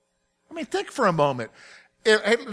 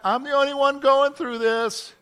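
self-pitying complaint; 8 semitones higher, 4 dB louder than usual. Retold or imitated. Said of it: "Don't you say that?".

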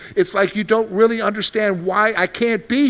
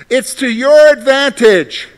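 slow, louder and higher; emphatic proclamation; 6 semitones higher, 8 dB louder, slower than usual. Real, not acted. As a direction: land the big line slowly. Preacher announcing the theme.

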